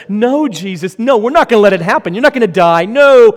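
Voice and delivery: loud, high, rushed protest; Peter protesting. Acted. Peter loudly protesting to Jesus that he won't die. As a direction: shout him down in a panic.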